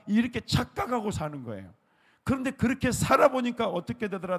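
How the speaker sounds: loud, high, urgent sermon delivery; preaching at full force. Acted. Pastor exhorting the congregation, loud high preaching. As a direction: drive the point home to the congregation.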